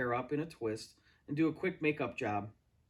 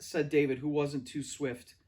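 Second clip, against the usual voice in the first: usual speaking voice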